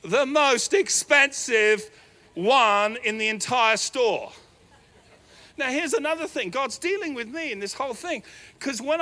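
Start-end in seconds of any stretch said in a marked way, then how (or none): 0.0-4.3 loud, slow, every word hammered; emphatic. Acted. Preacher telling the congregation about the dress, slow and loud. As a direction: drag out the punchline for the crowd. Mock outrage at daughter's pick.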